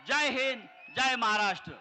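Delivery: loud rally slogan; slogan shout. Real, not acted. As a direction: belt out the closing cheer.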